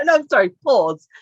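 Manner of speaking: normal talking voice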